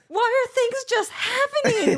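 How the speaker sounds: high, squealed helpless wail; hammed-up distress through laughter; retold panic. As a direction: act out being overwhelmed, pitch way up. Retelling overwhelmed panic, laughing.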